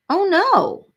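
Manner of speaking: high, loud gasped oh no; blurting out alarm at the hospital news; shocked oh no. Real, not acted. Streamer reads hospital news from chat, shocked oh no.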